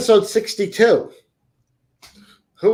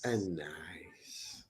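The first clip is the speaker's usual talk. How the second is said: soft, teasing stage whisper; 12 semitones lower, 19 dB quieter than usual. Acted. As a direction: breathe the words like a secret. Whispered echo of co-host.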